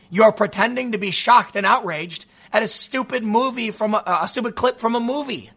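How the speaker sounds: ordinary talk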